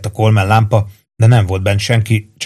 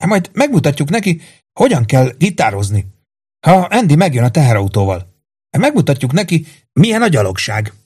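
cheerful boast with high starts; jolly, lilting from high to normal; 5 semitones higher than usual. A narrator's reading. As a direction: crow good-humouredly about the plan. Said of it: "szólalt meg jókedvűen Péntek".

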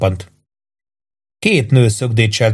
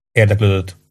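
ordinary delivery

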